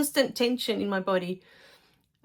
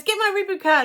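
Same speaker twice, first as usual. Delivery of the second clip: impatient demand; 10 semitones higher, 5 dB louder, faster than usual. Retold or imitated. Daughter demands again.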